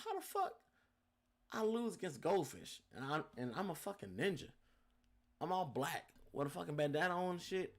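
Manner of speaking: high, outraged retelling of the loss; angry rant. Retold or imitated. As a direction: protest the loss in a high, indignant voice. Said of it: "So I was mad as hell".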